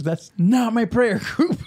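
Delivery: high, slow, horrified voice; hammed-up pious coworker; scandalized lady. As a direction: gasp it out, appalled. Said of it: "And she goes".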